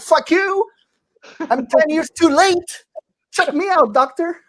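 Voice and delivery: high-pitched, hammed-up protest; mock indignation. Comic talking back to his doctor, indignant.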